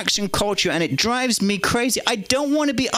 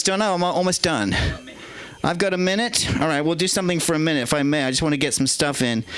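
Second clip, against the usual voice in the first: ordinary talk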